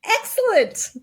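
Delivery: very high, loud burst of delight; delighted exclamation. Retold or imitated. Listener thrilled by a church's potluck offer, delighted. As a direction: exclaim it with gleeful approval. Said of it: "I'm like".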